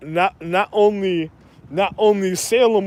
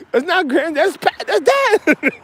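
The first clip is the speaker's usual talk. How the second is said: mock astonished correction; 9 semitones higher than usual. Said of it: "Forbes guys reacting".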